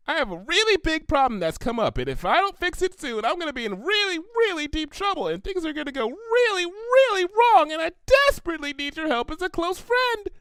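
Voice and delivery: high, breathless pleading pony voice; desperate Pinkie. Acted. Pinkie begging Twilight for help, high and breathless.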